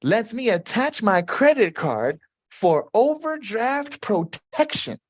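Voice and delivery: reading Connie's comment. Retold or imitated. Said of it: "Connie says".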